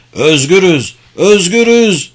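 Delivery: loud, high cry of freedom; shouting. A narrator's reading. Freed slaves to the other barracks, shouting. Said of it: "diğer barakalara doğru bağırıyorlardı".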